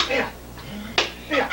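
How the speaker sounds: hushed, urgent whisper of a name. Retold or imitated. Dad creeps in to wake Bill, whispering. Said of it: "You know why he's whispering like that?".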